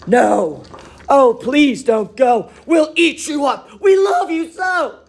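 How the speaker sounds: loud, high begging cry; pleading monsters. Acted. Wild things beg Max to stay, loud and high. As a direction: beg him not to leave. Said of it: "But the wild things cried".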